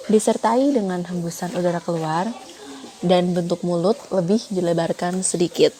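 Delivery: usual speaking voice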